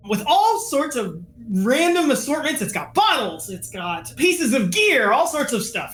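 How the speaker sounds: ordinary talk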